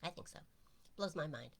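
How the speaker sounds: normal talking voice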